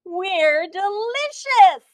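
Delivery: high, slow, loud and proud; a cheerful donut bragging. A narrator's reading. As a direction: savour each word proudly.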